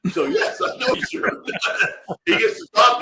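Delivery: breaking into laughter, voice jumping up high; laughing. Real, not acted. Host cracking up while reading a viewer's comment, laughing. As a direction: laugh your way through it.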